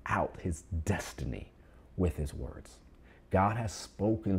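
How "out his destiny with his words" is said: hushed whisper. Acted. Preacher drops to hush.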